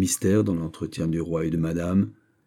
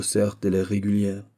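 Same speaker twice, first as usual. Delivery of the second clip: ordinary delivery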